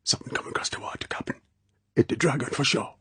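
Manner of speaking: urgent, hushed island dialect; fierce whisper. A narrator's reading. Quarrel waking his captain to danger, fierce whisper. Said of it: "Quarrel whispered fiercely".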